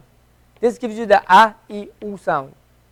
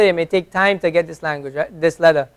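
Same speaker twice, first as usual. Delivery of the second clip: normal talking voice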